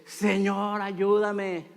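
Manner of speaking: drawn-out mock cry for help. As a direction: plead slowly, voice held back from a full shout. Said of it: "Es un grito de auxilio que dice".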